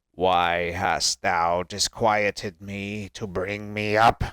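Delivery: low, very slow zombie voice; Samuel. Acted. Dead Samuel rebukes Saul for waking him, slow zombie voice. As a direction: drag the words out like the risen dead. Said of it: "I'm sorry, my zombie voice".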